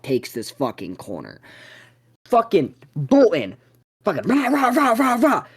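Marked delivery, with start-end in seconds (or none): 4.3-5.4 rapid high-pitched mock barking; a string of high, rough "rah" barks; imitated dog noise. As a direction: bark like a charging dog.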